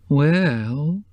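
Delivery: uncertain. A narrator's reading. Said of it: "she said uncertainly".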